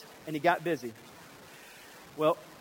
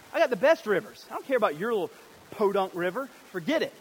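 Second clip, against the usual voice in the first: higher, louder scoffing tirade; indignant rant; 4 semitones higher, 4 dB louder than usual. Acted. Naaman scoffing at Israel's river, loud indignant rant. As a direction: boast and scoff. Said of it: "This is what he says".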